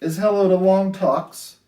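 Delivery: sing-song rhyming storybook read; lilting picture-book voice; reading to children. A narrator's reading. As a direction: read it gently to a class of little kids.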